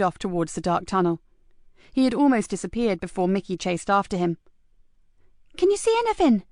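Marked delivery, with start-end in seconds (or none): 5.6-6.4 put-on higher, louder eager kid; a cheeky lad asking keenly; young boy voice. A narrator's reading. Mickey asks Marcus eagerly, in a high young boy's voice.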